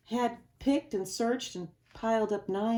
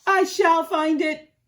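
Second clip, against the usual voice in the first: loud, higher determined vow; cried out; 8 semitones higher, 10 dB louder than usual. Acted. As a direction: declare it with fierce resolve. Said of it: "cried Horton".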